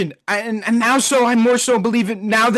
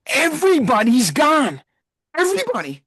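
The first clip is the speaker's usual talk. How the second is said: despairing cry; 5 semitones higher than usual. Real, not acted. Fan despairing over lost core.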